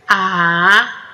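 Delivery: ordinary talk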